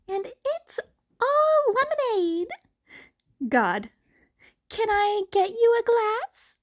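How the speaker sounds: high goofy voices; high comic lemonade seller; silly character voices. Acted. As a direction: play out the duck joke. Duck joke voices.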